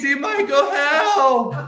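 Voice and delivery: high, loud mock cry for help; hammed-up grandma shouting from upstairs; grandma calling for help. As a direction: call out like a frail grandma in trouble. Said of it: "And I hear".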